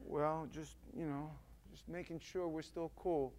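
small, soft, hesitant backpedal; sheepish reply. Acted. Acted sheepish reply to God's scolding.

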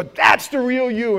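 high, slow, loud taunt; loud mock jeering. Friends taunt you after you rise up, loud jeer. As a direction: throw it at them like jeering friends.

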